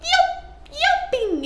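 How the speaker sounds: very high squeaky yelp. Acted. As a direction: squeak it like a peacock. Peacock yelp imitation.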